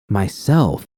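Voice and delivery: ordinary delivery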